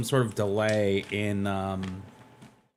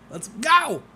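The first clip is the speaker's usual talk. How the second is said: high cheer and laugh; 12 semitones higher than usual. Real, not acted.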